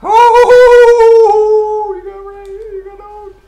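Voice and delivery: very high, very loud yelp; shocked scream. Real, not acted. Gamer shrieking as something explodes near him. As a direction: jolt of pure surprise. Sudden surprise in game.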